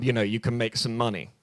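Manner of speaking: ordinary talk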